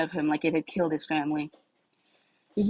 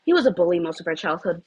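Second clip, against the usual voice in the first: ordinary delivery, nothing marked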